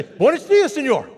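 high loud Buenos dias; cheerful reply. Retold or imitated. Tourists finally greeting the attendant cheerfully. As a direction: answer brightly. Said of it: "We say".

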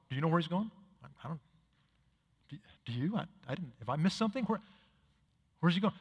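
soft, unsure whispering among friends; hushed confused murmur. Retold or imitated. Disciples murmuring to each other, hushed and confused. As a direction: mutter it like you're afraid to be overheard.

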